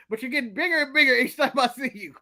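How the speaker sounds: ordinary talk, nothing marked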